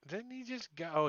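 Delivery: ordinary talk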